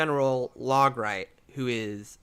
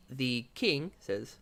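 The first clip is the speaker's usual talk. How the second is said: ordinary talk, nothing marked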